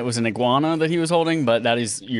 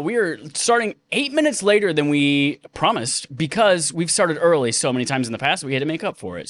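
normal talking voice

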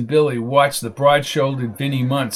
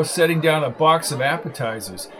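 ordinary delivery

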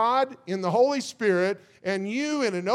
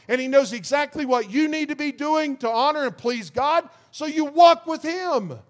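higher, louder revival-preacher drive; full-force preaching; 4 semitones higher, 4 dB louder than usual. Real, not acted. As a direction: build it up like a call to the congregation.

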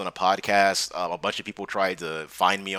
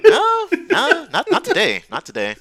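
higher and louder, breaking into laughter; laughing; 7 dB louder, slower than usual. Real, not acted. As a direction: laugh the words out as a retort. Laughing retort to the interviewer.

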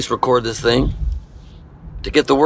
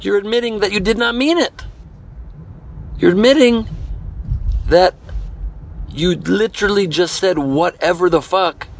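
incredulous; 7 semitones higher than usual. Real, not acted.

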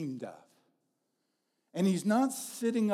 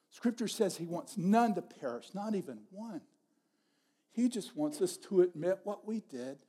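ordinary delivery

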